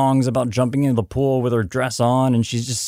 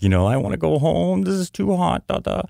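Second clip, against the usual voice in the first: put-on much higher, childlike whining; daughter's voice; 9 semitones higher than usual. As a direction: play the little girl who wants to go home. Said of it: "she's like".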